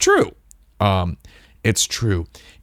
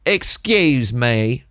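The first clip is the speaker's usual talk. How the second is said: drawn-out exaggerated Midwestern accent; mock Midwestern accent; much slower than usual. Tourist girl addressing a stranger on the train, mock Midwestern accent. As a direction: stretch it into an overdone Midwestern twang. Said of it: "She doesn't say it that way".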